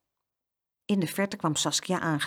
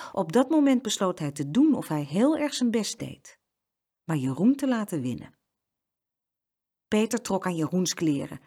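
plain reading voice

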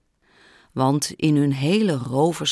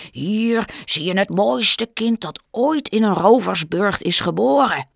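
proud father; 6 semitones higher, 5 dB louder than usual. Acted. Mattis shows off his baby.

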